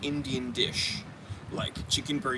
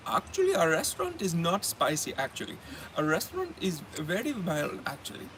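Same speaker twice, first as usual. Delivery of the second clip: imitated Indian-accented insistence; 2 semitones higher than usual. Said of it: "I'm gonna do the accent".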